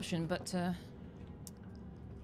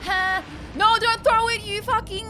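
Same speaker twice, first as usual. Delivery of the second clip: high panicked yelling; being chased; 14 semitones higher, 12 dB louder than usual. Real, not acted. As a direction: yell while fleeing.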